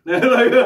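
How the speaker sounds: high, loud burst of laughter. Real, not acted. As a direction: break up laughing mid-thought. Laughs at killing his broken part.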